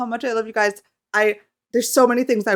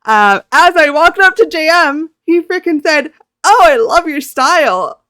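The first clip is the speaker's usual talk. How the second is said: high, loud fangirl gushing; excited gushing; 10 semitones higher, 9 dB louder than usual. Real, not acted. Fan retelling her bias's compliment on her style, gushing excitedly. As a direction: gush loudly, drawing out the compliment.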